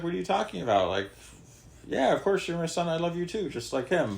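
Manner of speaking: a fond father surprised by his son's complaint; loving father. Retold or imitated. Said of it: "the father in the parable is like".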